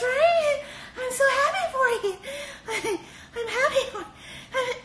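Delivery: very high, giggly, near-tearful gushing; fake-happy put-on voice. [name] faking joy for Barnett, very high giggly voice. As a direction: squeak out fake congratulations. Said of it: "her pussy service voice".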